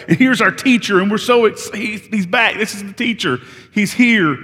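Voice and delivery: excited recognition. Retold or imitated. Mary remembers her teacher is back, excited.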